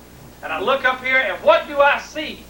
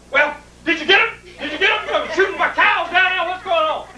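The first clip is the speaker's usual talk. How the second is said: high, urgent questioning; agitated dad; 6 semitones higher than usual. Retold or imitated. Dad asks if Bill shot the rustlers, agitated. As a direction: imitate an alarmed father demanding answers. Dad quizzes son about shooting.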